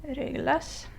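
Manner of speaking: "Reglas" pronounced slowly in Spanish, softly; pronunciación española. Shown as a demonstration. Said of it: "If you wanna know how it is in Spanish".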